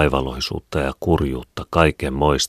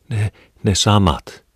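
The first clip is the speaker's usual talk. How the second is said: higher, slow, halting fear; frightened; 7 semitones higher, 4 dB louder, slower than usual. A narrator's reading. Wife to husband about the night visions: slow, fearful stammer. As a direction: stammer it out, still haunted.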